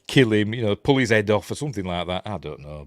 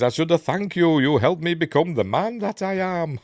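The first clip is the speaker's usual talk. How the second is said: villain voice; 8 semitones higher, 4 dB louder than usual. Retold or imitated. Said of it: "and he says".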